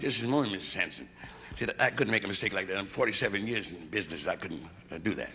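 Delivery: put-on low, soft voice of a fussy old doctor. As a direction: mumble it like an elderly doctor. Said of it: "Doctor said".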